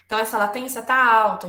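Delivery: ordinary talk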